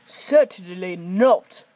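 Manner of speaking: loud, slow indignant huff; huffy. Acted. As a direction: refuse proudly and loudly. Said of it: "huffed Gordon".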